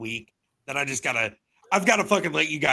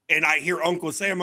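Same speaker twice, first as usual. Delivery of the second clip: ordinary talk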